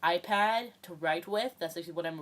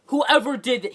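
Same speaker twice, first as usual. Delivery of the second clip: loud, stern principal's call; furious principal yelling at the homeroom; principal's shout; 5 semitones higher, 11 dB louder than usual. Retold or imitated. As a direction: bellow it at the whole class. Principal bursts in holding the bomb.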